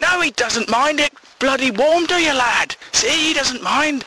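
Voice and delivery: high, insistent mock arguing; bickering retort. Boyfriend drags his mate into the butter row, high and insistent.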